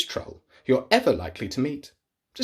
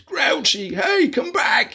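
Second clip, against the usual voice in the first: calling out; 11 semitones higher, slower than usual. Acted. Troll shouting after his friend, desperate call. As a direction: shout after him to come back. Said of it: "he called".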